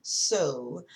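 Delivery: elongated "So", held before carrying on; drawn-out SO. Real, not acted. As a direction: lean into a long O on "So". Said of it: "there's four O's after the S".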